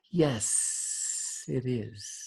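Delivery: soft whispered snake voice; whispery snake. Acted. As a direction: hush it like a sly snake. Snake answers elephant.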